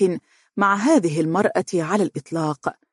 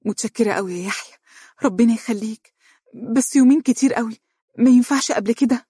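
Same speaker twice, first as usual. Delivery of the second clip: grateful, growing eager; 6 semitones higher, faster than usual. Acted. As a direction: thank him, then plead eagerly. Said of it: "تقول ممتنة".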